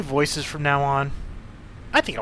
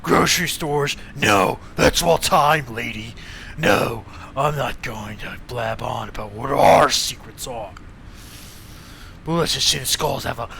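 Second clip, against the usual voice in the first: gang thug voice; 4 semitones lower than usual. Acted. Thug voice continues.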